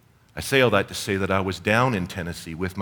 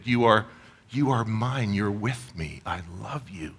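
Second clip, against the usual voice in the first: tender declaration. Shown as a demonstration. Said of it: "models telling a beloved".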